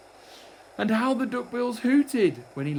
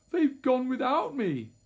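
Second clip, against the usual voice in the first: high, wailing and hurt; plaintive cry; 9 semitones higher, 4 dB louder than usual. A narrator's reading. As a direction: wail it like an abandoned little one. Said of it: "And he cried".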